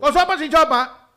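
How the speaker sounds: imitated chifa shout. Answering the chifa man in his dialect, mock shout. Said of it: "cuando nos grita".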